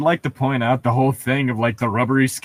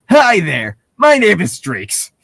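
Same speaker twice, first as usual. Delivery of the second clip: voicing Streaks; 8 semitones higher, 6 dB louder, slower than usual. S0 voices Streaks greeting Bella.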